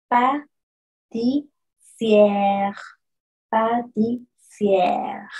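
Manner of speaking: pâtissière very slow, open è; French pâtissière. Shown as a demonstration. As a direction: exaggerate pâtissière slowly. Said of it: "Miren cómo yo pongo la posición de mis labios".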